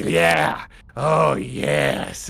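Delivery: low raspy voice of a lifelong smoker. Acted. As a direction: rasp it like she smoked all her life. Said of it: "raspy voice".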